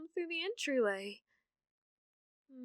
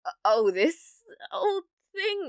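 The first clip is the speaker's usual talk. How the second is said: caught out; 8 semitones higher, 10 dB louder than usual. Acted. Caught holding the album.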